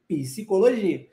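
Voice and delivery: psicologia with the P sounded; Portuguese pronunciation. Shown as a demonstration. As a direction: say psicologia slowly, voicing the P. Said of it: "Mas você pronuncia o P".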